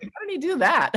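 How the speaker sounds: high, mock astonished question; amazed son. Son amazed at his unhandy father, high mock astonishment. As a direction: marvel at it in disbelief. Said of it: "And I'm like".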